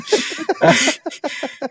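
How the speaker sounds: cracks up at the story; high burst of laughter. Real, not acted.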